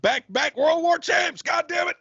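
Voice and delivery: high, drawn-out rallying yell; rallying cry. Pretend. Urging the country back to a war mindset, high rallying yell. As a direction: crow it high like a proud champion.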